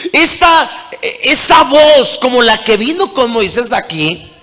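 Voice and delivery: loud, high preaching at full force; preacher driving the call home, loud and high; fiery preaching. Acted. Preacher urging the congregation, loud and high, at full force.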